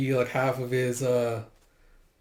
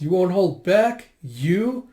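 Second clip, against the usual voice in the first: slow, raised, incredulous questions; mocking amusement; 5 semitones higher, 5 dB louder, much slower than usual. Soldier scoffs at Yona's threat, mocking amusement. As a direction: throw it back at her, rising. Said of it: "He starts laughing".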